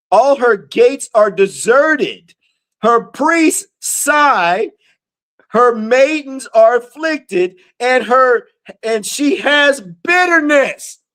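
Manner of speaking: slow, deliberate verse reading; scripture reading. Acted. Preacher reads verse three to listeners, slow scripture reading. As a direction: read it out word by word, slowly.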